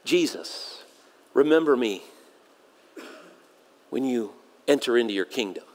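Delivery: low, soft, halting plea; groaned plea. Acted. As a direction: groan it out softly with long pauses. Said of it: "he groans out to the Savior".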